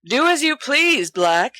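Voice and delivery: high, carrying mock-stern call; booming woman. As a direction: boom it down from above. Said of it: "the voice booms".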